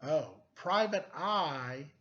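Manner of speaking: ordinary delivery, nothing marked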